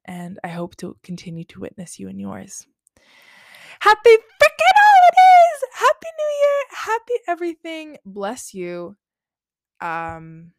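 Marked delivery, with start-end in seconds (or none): 3.8-8.0 much higher, much louder squealing cheer; excited shout. Real, not acted. Host wishing listeners happy holidays, joyful shout. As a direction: burst out with holiday joy, fading down.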